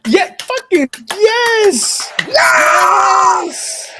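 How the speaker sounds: cheering yell of joy; excited shout. Real, not acted. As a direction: shout it out in triumph. Production news, cheering.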